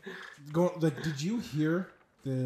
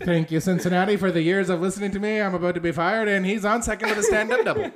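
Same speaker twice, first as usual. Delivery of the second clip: loud, high, rapid announcer's farewell; baseball voice; 5 semitones higher, 8 dB louder, much faster than usual. Acted. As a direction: belt the goodbye straight into play-by-play. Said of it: "think of the baseball voice".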